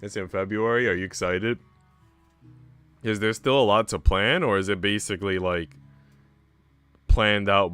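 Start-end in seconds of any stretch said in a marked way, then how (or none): none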